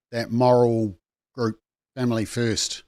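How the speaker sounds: dry sarcastic dig, low. Pretend. Ironic dig at own group.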